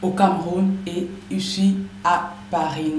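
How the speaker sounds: ordinary talk, nothing marked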